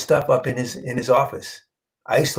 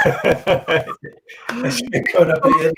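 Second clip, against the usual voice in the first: said through a high laugh, slowly; 11 semitones higher than usual. Real, not acted. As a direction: laugh the joke out, voice jumping up. Joking about wrong country.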